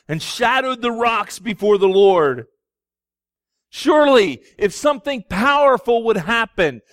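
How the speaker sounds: loud, rising sermon build; booming pulpit delivery; full-force preaching. Acted. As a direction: preach it out with fire.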